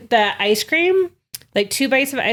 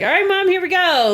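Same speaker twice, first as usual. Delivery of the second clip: cheerful quote; 10 semitones higher than usual. Retold or imitated. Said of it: "and I was like".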